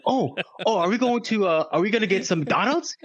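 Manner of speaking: high, eager questioning girlfriend impression; mock hopeful excitement. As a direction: act pleasantly surprised, voice lifting into the question. Joke, acts the hopeful date.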